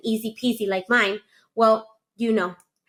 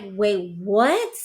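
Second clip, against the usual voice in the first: low "wait", then "what" swooping up; incredulous rising question; retold shock; 4 semitones lower than usual. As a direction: replay a stunned double take. Retold reaction to husband's baby news.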